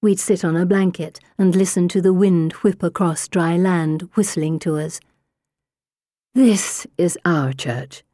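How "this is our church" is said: lovingly, slowly; tender. A narrator's reading. Mother remembered, tender.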